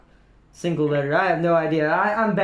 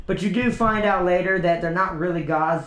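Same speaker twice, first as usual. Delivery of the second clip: usual speaking voice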